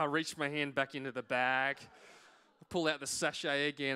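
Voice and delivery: ordinary delivery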